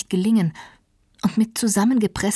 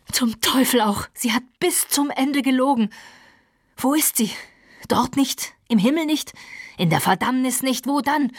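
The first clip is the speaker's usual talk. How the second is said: high, frantic outburst of grief and rage; raging passion; 6 semitones higher than usual. Acted. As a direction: tear the words out in a frenzy. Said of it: "in einem plötzlichen Anfall unbezähmbarer Leidenschaft".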